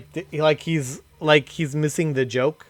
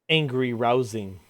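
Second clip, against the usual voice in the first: usual speaking voice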